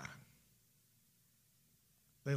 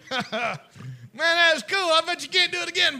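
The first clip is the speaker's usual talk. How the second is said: fast, loud, higher goading dare; taunting dare; 10 semitones higher, 12 dB louder than usual. Retold or imitated. Boys goad the reckless old boy with a loud dare. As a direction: egg him on to try it again.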